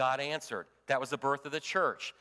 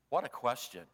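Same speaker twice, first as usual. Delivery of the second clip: usual speaking voice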